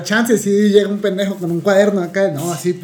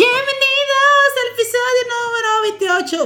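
announcer intro. Acted. Host opening the podcast episode, in a high hype announcer voice.

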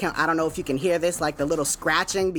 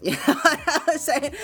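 higher-pitched, laughed-through aside; laughing; 8 semitones higher than usual. Real, not acted. Host amused at being done with it, real laughing. Laughs at his own fed-up quote.

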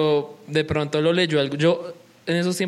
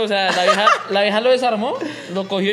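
loud laughing amazement; excited laughing disbelief; 5 semitones higher, 4 dB louder than usual. Real, not acted. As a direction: laugh out the question.

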